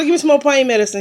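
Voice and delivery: retold pleading. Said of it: "I just started hollering".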